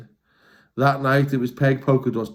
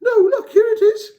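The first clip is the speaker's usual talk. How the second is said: very high, louder squeal of discovery; excited cry; 6 dB louder than usual. Acted. Peg finding the chest, excited cry. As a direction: squeal it out as a find. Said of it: "cried Peg Polka Dot".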